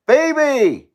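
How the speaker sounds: calling the bird. Real, not acted. My bird's screaming for me.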